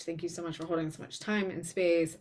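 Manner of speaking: ordinary delivery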